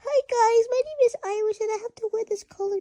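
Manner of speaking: high cutesy cat voice for Iris; voicing the cat. Owner voicing his cat Iris to viewers, high and cute. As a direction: speak for the cat in a squeaky pitch. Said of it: "Iris, say hi".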